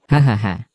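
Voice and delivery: short gloating laugh; mocking laugh. Villain laughing at the secretary, a short mocking laugh. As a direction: laugh it off at her expense.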